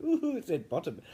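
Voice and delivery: put-on high, loud, childish "ooh" of delight; mock giddy reaction. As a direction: act out tickled amusement at a rude word. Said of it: "and I went".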